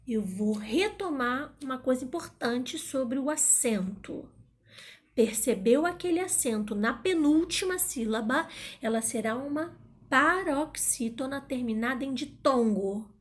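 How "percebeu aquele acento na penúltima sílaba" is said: ordinary talk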